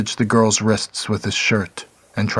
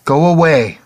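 frightened shout; 7 semitones higher, 7 dB louder than usual. A narrator's reading. Girl fending Clark off.

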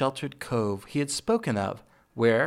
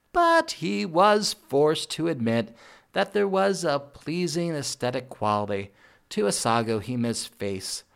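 ordinary delivery, nothing marked

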